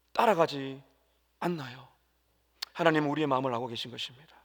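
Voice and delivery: normal talking voice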